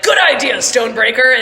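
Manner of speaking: high, loud cheer of approval; enthusiastic agreement. Acted. Merrick shouts agreement to Stonebreaker, loud and eager. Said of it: "Merrick is just like".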